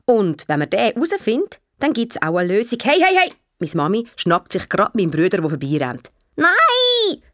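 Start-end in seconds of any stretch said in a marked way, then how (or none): 2.8-3.3 high, urgent hey-hey-hey; calling to halt. Acted. Mother catches son running by, urgent call. 6.3-7.2 very high, drawn-out squeal of refusal; defiant child's shout. Acted. Brother refuses his jacket, high defiant shout. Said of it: "ruft er".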